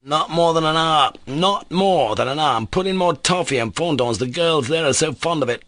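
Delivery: eager, heated insisting; insisting hotly. A narrator's reading. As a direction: press the point hotly. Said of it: "Mitya insisted hotly".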